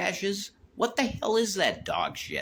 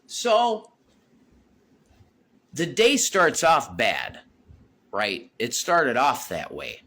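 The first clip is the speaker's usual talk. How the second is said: normal talking voice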